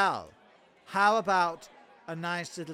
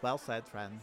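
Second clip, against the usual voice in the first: butter him up quietly; soft fawning; 9 semitones lower, 12 dB quieter than usual. Acted. Fawning courtier praising the visiting king softly. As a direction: lower and much softer, fawning.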